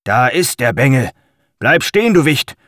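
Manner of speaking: throaty guard shouting after a thief. Acted. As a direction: bark it out like an angry city guard. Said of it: "eine kehlige Stimme ertönte". (kehlige, rufende Wächterstimme)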